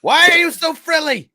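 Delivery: very high, loud wail; mock outrage. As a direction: cry out at the villain in hammed-up despair. Joins in shouting at villain.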